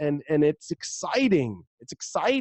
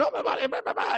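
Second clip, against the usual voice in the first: giddy monkey; 10 semitones higher, 4 dB quieter than usual. Retold or imitated.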